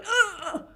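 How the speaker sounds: high, strained mock grunt; imitated lifting grunt. Showing someone picking up weights, imitated strained grunt. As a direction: imitate straining under heavy weights, breath held. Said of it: "weights and going".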